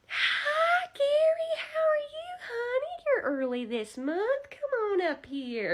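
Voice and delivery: very high, sugary grandma greeting; high doting old-lady voice; sweet old lady voice. Retold or imitated. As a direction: coo at him like a doting granny teller. Narrator voices sweet old bank teller.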